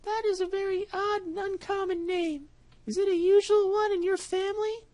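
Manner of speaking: a trusting mouse sending him off; little mouse. Acted. Mouse puzzled.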